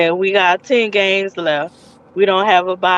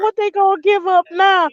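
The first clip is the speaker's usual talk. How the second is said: high, despairing mock lament; exasperated plea; 10 semitones higher than usual. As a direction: whine it like a fan who can't bear to watch, much higher. Imitating himself dreading the defense.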